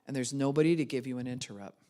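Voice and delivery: ordinary delivery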